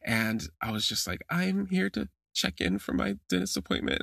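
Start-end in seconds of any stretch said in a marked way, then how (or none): 1.3-4.0 small high-pitched nervous voice; mock-timid and pitched up; scared. Scared patient checking in at the dentist, timid high voice. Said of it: "I'm so scared".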